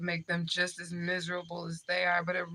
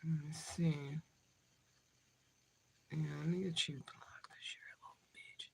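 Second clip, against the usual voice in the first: soft whisper under her breath; 2 semitones lower, 14 dB quieter than usual. Real, not acted. Muttering to herself as she blocks the troll, whispered.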